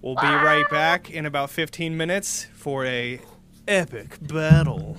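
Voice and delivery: loud, hyped show host; announcer voice. Acted. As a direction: pump up the audience for the break. Announcing break to audience.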